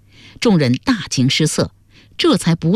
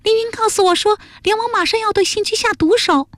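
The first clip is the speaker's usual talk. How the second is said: very high, small voice giving alarming news; urgent girl character. Acted. Jialuo warns friends of a murder plot, urgent and high. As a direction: warn them in a high-pitched girlish voice.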